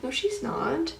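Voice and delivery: put-on calm her down like a frightened child; reassuring mom. Mom wiping tears, reassuring.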